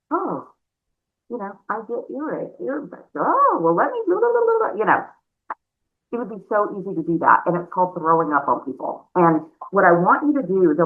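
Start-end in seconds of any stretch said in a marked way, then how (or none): 0.1-4.8 mock rambling seller.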